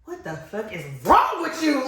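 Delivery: mock road-rage yell, drawn out; retold yelling. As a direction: cuss out a driver, dragging the words. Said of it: "while I'm yelling at this dude".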